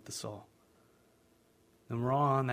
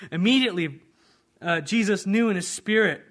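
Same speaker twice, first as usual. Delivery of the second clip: normal talking voice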